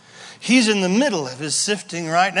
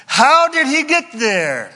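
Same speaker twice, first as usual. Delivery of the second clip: emphatic; 7 semitones higher, 5 dB louder, slower than usual. Acted. Sermon title question emphasized.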